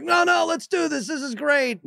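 hammed-up eager dad pitch; overeager dad. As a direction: sell it hard to the kids. Dad pushing Atari on kids.

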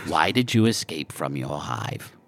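hushed, unvoiced alien whisper. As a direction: breathe the question out softly. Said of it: "whispered repeat of Scorpo's question".

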